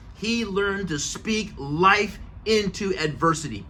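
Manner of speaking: ordinary talk, nothing marked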